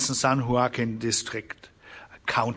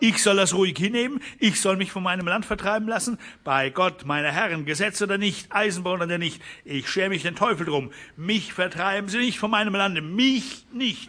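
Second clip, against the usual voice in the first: higher, fast, defiant declaration; the rancher defies the railroad before the others; defiant outburst; 6 semitones higher than usual. A narrator's reading. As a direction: refuse to be driven off the land. Defiant rancher refuses eviction.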